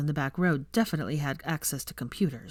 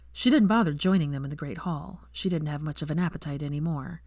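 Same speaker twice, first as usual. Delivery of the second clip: usual speaking voice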